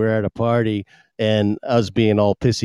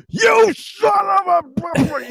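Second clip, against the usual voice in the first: much higher, hammed-up enraged shout, slowly; mock the furious friend's outburst; furious retold yell; much slower than usual. Friend with beer, mid-fight, hammed-up furious yell.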